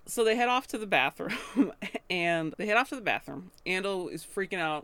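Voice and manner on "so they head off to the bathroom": laughing through it. Real, not acted. Retelling while laughing.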